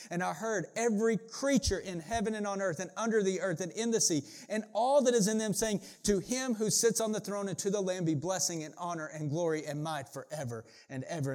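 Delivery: high, driving Bible proclamation, settling at the end; fervent public reading; scripture reading. Acted. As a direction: carry the praise to a climax. Reading at its climax.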